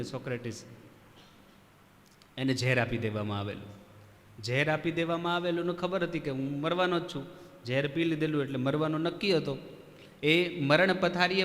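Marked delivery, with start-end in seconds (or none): none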